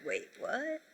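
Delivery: hushed, rising double-take; very quiet puzzled aside, voice lifting; baffled inner reaction. Retold or imitated. Shopper silently reacting to the clerk's question, baffled and hushed. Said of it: "I didn't say it like that".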